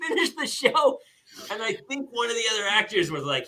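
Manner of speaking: high-pitched, words squeezed out through laughing; real giggling that breaks up the sentences; helpless laughter. Real, not acted. Telling how the fake show fooled everyone, laughing helplessly.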